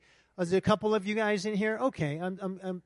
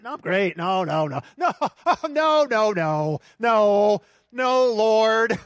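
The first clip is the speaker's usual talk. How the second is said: loud, laughing no no no; loud refusal; 2 semitones higher, 8 dB louder, slower than usual. Retold or imitated. Preacher refusing God's warning, loud and laughing.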